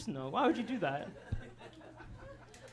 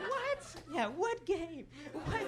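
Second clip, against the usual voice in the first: baffled what; 11 semitones higher, much slower than usual. Retold or imitated. Mock baffled reaction to sports.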